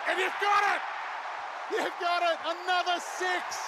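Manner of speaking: commentator roaring at a six; excited shout. Real, not acted. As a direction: call it out in delight. Live six being called.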